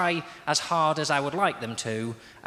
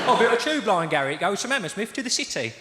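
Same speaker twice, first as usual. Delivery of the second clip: Cockney; 6 semitones higher than usual. Retold or imitated.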